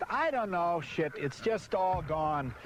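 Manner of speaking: usual speaking voice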